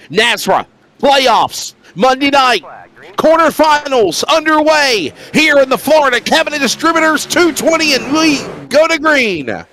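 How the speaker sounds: high, ringing green-flag call; race-start announcer. Real, not acted. Commentator launching the playoff quarterfinals for viewers, high announcer call. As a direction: belt out the start of the race, drawing out the last words.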